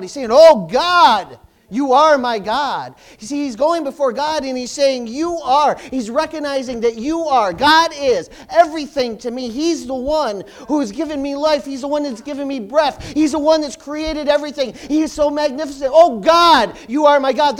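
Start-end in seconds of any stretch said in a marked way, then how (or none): 0.3-2.9 loud, slow cry to God. Shown as a demonstration. Psalmist crying out to God, loud and slow. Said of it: "No, he's crying out". 3.2-15.9 loud, driving sermon voice; full-force preaching. Acted. Preacher building up the congregation, loud. 15.9-17.6 very loud cry to God. Acted. Psalmist crying out to God, loud. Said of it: "No, he's crying out".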